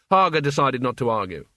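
usual speaking voice

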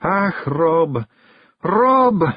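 high, plaintive Scots wife; beginning to cry. A narrator's reading. Jeanie to her husband, on the verge of tears. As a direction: plead his name, voice climbing. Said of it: "beginning to cry".

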